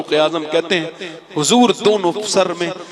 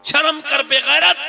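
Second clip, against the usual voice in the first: scolding; 9 semitones higher than usual. Acted. Mother scolds drunk son.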